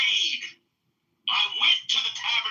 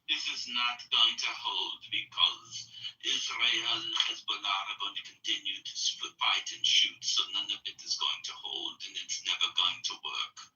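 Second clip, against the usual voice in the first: quoting a guest; 14 semitones lower, 8 dB quieter than usual. Retold or imitated. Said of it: "and said".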